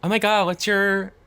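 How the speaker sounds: put-on high, bright Valley Girl lilt; Valley Girl accent. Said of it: "a Valley Girl accent?".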